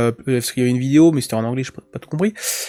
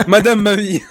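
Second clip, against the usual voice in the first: mock formal; 6 semitones higher than usual. Mocking polite address.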